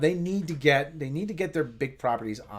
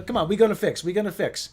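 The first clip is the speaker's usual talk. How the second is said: imitated Italian-accented dad, pitched up; Italian father; 6 semitones higher, 4 dB louder than usual. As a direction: mimic your Italian father insisting. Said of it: "that's typical of my father".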